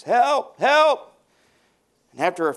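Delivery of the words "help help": high, loud cries for help from a falling man; yelling for help. Retold or imitated. Man hanging from a cliff screams for rescue, loud and high. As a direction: shout it like you're dangling off a cliff. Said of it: "he began to yell for help, the top of his lungs".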